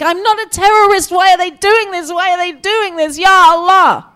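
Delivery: high, loud desperate wail; anguished high cry, peaking on the last words; retold screaming. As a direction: re-enact an old woman screaming in protest. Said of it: "until she was screaming in Arabic".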